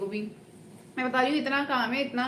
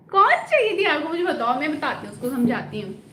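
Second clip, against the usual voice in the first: eager demand; 3 semitones higher, 6 dB louder than usual. Real, not acted. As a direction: jump in loudly demanding to know. Mock-indignant reply to lovelorn viewer.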